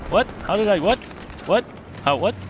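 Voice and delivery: can't believe what just happened; baffled disbelief. Real, not acted. Gamer stunned by a sudden death on screen, high disbelieving what.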